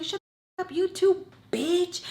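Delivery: high, indignant retort; retold high-pitched outburst; angry comeback. Her yelled comeback at neighbor.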